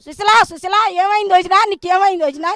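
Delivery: loud mock calling of a name, pretending to be worried; feigned alarmed calling. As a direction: call out her name as if shocked, but it's an act. Man feigning concern, calling victim.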